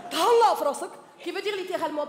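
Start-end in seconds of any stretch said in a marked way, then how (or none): 0.1-0.9 put-on louder, threatening Moroccan accent; Moroccan menace. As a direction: say it like you'll hit them. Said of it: "on dirait qu'ils vont te frapper". (accent marocain menaçant)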